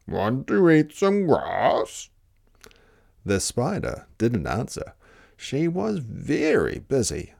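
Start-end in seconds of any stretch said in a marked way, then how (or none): 0.1-2.1 slow, drawn-out mooing question; cow voice. Acted. Cow inviting the spider to graze, slow cow voice. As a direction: stretch the words like a cow. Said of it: "said the cow".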